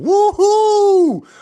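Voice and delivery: high, loud cheer of delight; excited celebratory woohoo. Real, not acted. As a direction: whoop with joy for her. Cheering on a member's progress.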